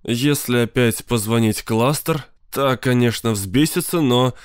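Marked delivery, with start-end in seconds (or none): none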